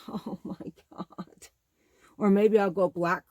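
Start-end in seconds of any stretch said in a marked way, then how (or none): 0.1-1.5 hushed, drawn-out swoon; awestruck whisper. Real, not acted. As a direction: whisper it slowly, overcome with delight.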